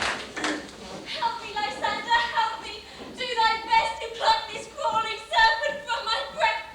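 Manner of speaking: loud panicked cries for help; shrieking awake from a nightmare; terrified scream. Acted. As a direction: scream for Lysander to save you from the serpent. Hermia waking from nightmare.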